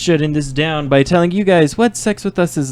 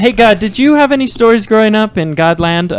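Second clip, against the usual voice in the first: mildly raised, higher voice; pitched up as if addressing the heavens; calling up to God; 6 semitones higher than usual. Pretend. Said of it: "You don't need to yell".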